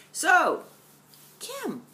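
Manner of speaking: high, quick imitated viewer asking; viewer's question voice. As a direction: pipe up like a curious viewer. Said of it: "you ask?".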